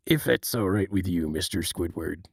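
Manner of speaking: low, soft, earnest crab boss; deep and serious, coaxing an employee back; serious Mister Krabs. Retold or imitated. Mister Krabs gently asking Squidward back to work, low. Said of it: "Mister Krabs when he's talking".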